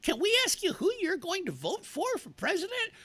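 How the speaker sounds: squeaky mock campaign-texter voice; Dave. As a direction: parody a canvasser in high falsetto. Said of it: "wanting to know".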